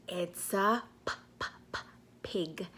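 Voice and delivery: soft broken p- p- p- then a whispered pig; soft p- p- p- build to a whispered pig; sound it out. Shown as a demonstration. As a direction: tease the first sound before revealing pig. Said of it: "stuttering first sound p".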